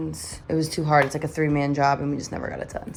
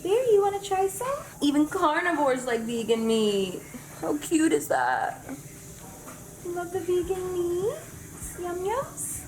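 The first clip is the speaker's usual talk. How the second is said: high cooing voice for the dog; 11 semitones higher than usual. Real, not acted. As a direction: coo at the pup. Feeding the dog.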